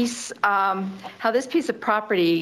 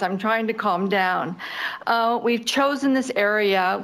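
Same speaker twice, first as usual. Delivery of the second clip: ordinary talk, nothing marked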